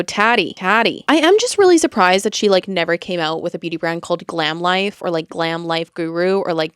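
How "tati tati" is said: Tati said with a flat a, like tat-ee; Canadian accent. Shown as a demonstration. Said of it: "my Canadian accent just wants to go".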